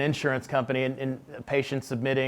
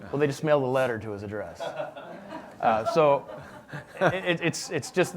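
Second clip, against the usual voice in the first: chuckling through the punchline, a bit fast; laughing in disbelief. Real, not acted. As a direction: laugh at how absurd it is. Laughs at absurd insurance letter.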